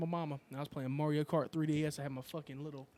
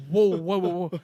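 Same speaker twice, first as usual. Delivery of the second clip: startled whoa; 3 semitones higher, 12 dB louder than usual. Retold or imitated. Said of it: "I'm like".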